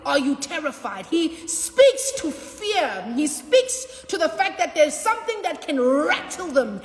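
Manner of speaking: rising, ringing sermon climax; full-force preaching. Real, not acted. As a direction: preach it out to the congregation.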